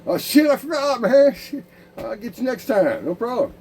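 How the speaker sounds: loud, high mock-casual excuse; careless brush-off. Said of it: "And it's like".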